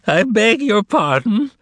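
put-on high, crackling old-man voice; crackly voice. A narrator's reading. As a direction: crackle it out, mock polite. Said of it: "crackled the tall man".

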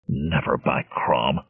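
low, soft growled oath; growling. Acted. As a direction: growl it, bristling. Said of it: "growled Conan, bristling".

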